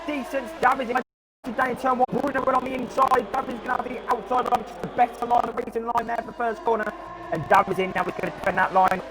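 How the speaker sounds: fast, high-pitched race start call; motorsport commentator. Real, not acted. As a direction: call the start live and rising.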